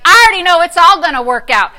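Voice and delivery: high, loud, sassy put-down. Pretend. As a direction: shout down the devil with attitude. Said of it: "you gotta get sassy".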